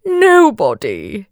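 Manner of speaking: high, slow storybook whimper; hammed-up, drawn-out self-pity; mock whimper. A narrator's reading. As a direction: whimper it like a sorry king. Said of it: "he whimpered".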